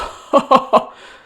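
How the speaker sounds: loud breathy gloating laugh; ram's big laugh. Acted. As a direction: laugh at the beaten wolf. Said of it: "laughed Rambo".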